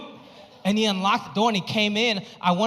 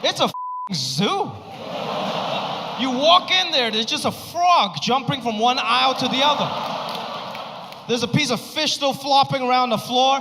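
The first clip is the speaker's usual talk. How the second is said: excited shout; 5 semitones higher than usual. Real, not acted. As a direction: paint the chaos with rising energy. Describing chaotic grocery store.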